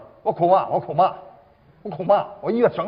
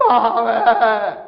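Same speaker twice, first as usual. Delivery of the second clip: mock wail; 4 dB louder than usual. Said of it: "进门就哭".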